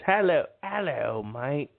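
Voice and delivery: mock English accent; bad English accent. Shown as a demonstration. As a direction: try an English accent and fail. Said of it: "I really can't do an English accent".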